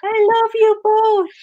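high, slow hammed-up queen; imitación de la reina Anne. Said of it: "cuando entra la reina y". (reina melodramática imitada, con voz aguda y alargada)

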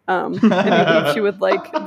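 ordinary talk, nothing marked

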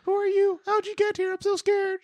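very high, squeaky mock kid voice; terrified little boy. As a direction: squeal it like a scared little brother.

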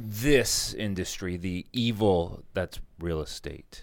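slow, low, quiet ominous voice. Acted. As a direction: say it like a doom prophecy.

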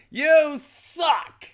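high, loud heckling cry; high mock yell. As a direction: yell it at a bad guitarist. Joining the heckler's verdict.

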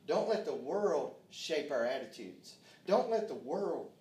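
loud, rousing preacher's cadence, pushing each line; rally the church with a loud, stretched preaching call; preaching at full force. Acted. Preaching to the congregation.

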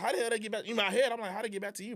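high-pitched disbelief; incredulous inner reaction. Retold or imitated. Thinking how did this get back to him, incredulous. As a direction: replay your thoughts in disbelief.

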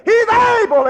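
high, loud, slow declaration; preaching shout. Real, not acted. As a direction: proclaim it with full force. Preaching climax.

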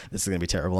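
ordinary talk

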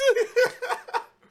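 high burst of laughter; loud laugh. Real, not acted. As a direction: cackle out loud.

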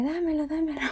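high-pitched mock panicked plea; retold panic. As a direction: act out begging for it back in a panic.

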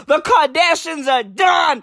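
normal talking voice

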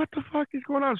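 lost and incredulous; bewildered. Retold or imitated. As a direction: squeak it out in retold disbelief. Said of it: "so shocked at the setup".

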